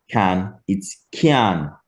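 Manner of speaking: kyan slow, k then quick i then an; short y glide. Shown as a demonstration. Teacher showing the Jamaican y sound, slow kyan. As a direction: put a short i after the k in kyan. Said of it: "pronounce it like a short I".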